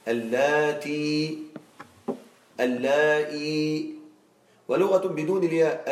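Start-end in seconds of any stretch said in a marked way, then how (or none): 0.1-1.4 اللاتي stretched very slowly, every syllable long; teacher stretching اللاتي out syllable by syllable; drawn-out demo. Shown as a demonstration. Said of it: "لغة بالياء". 2.6-4.0 اللاتي stretched very slowly, every syllable long; teacher stretching اللاتي out syllable by syllable; drawn-out demo. Shown as a demonstration. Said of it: "لغة بالياء".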